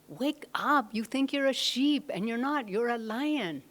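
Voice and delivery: high, loud, emphatic storybook lion; rousing lion. Retold or imitated. New lion waking the sheep lion, acted loud urging. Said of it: "just trying to wake him up".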